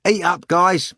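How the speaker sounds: loud, high call across the hall; a cook shouting to the audience. Acted. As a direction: bellow it to the crowd. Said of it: "the cook shouted".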